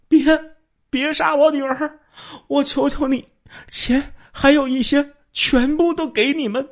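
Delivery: high, slowed desperate begging; mother begs for her daughter's life; trembling plea. Acted. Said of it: "他这个颤抖地说". (颤抖着哀求)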